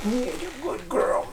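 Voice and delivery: high, soft cooing to the dog; sweet pet praise, lifted and hushed. Real, not acted. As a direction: coo it up high like praising a puppy. Cooing to her dog Huck.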